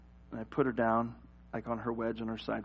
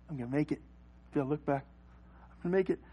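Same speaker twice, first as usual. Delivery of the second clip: soft, secretive self-encouragement; acted quiet hope; hushed tiptoeing; 9 dB quieter, much faster than usual. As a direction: say it under your breath, creeping away. Tiptoeing away from sleeping baby.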